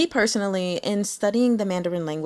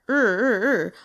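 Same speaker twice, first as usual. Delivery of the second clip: repeated "er" pulsing up and down like an engine; car-revving "er". Shown as a demonstration. Teacher comparing a vowel to revving a car, pulsed "er". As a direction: rev the "er" sound in waves. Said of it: "like revving up your car".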